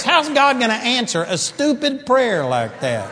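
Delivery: ordinary talk, nothing marked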